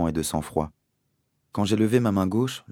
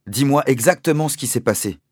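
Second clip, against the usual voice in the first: urgent demand; 7 semitones higher, 5 dB louder than usual. A narrator's reading. Father demands the story.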